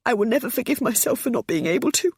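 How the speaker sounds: high, breaking sobbed confession; mother sobbing out her guilt to her son. A narrator's reading. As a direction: let the voice break on a sob. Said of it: "Her voice broke as she sobbed".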